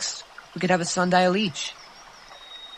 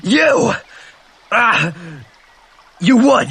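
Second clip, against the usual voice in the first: much higher, louder indignant cry; furious outburst; 7 semitones higher, 6 dB louder, slower than usual. Acted. Piggy struck by Jack, furious outburst. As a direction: yelp and lash back in outrage.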